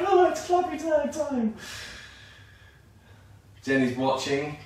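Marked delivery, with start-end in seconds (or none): 0.1-1.5 high, squeaky voice of mock dread. Teacher imitating her own nerves as the clappy tag comes up, high mock dread. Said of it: "I'm like".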